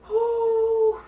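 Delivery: long, high, loud exhale; reenacted sigh of relief; big relieved whew. Relief when baby is pulled out.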